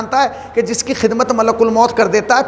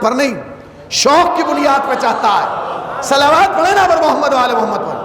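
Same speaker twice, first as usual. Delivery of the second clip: raised, ringing preacher's voice building to the salawat call; rousing sermon; 4 semitones higher than usual. Acted. Preacher rousing the mourners toward salawat, impassioned. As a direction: preach it out to the gathering.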